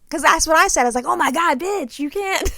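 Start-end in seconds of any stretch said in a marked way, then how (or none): 1.1-2.5 high-pitched, retold disbelief; scandalized reaction. Viewer reacting to the double homicide video, scandalized. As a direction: exclaim it high, half amused.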